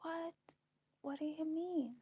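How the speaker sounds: high-pitched, incredulous question; disbelief. Retold or imitated. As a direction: act out someone who can't believe it. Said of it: "he couldn't believe it".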